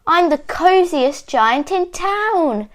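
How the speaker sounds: high, gleeful storybook exclamation; joyful cry without shouting; happy cry. A narrator's reading. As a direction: announce it proudly, pitch lifted. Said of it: "he cried".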